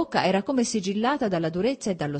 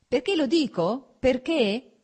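higher, louder irritated repetition; husband angrily answering his wife, higher and louder; 7 semitones higher, 5 dB louder, slower than usual. A narrator's reading. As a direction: snap the question back. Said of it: "continuò sempre irritato lui".

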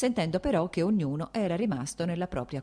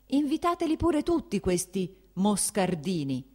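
loud, higher furious tirade; angry shouting; 4 semitones higher, 5 dB louder than usual. Acted. The prince blaming his wife for the evening, angry shout. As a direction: hammer every accusation home.

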